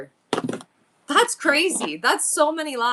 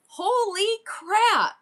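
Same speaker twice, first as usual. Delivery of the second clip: stunned; 9 semitones higher, much slower than usual. Real, not acted. Reading her yearly stats.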